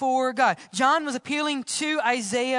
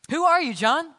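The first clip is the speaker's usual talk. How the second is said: higher, louder questioning; pressing demand; 6 semitones higher, 5 dB louder than usual. Acted. Delegation asking John who he is, pressing demand. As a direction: demand an answer with raised voice.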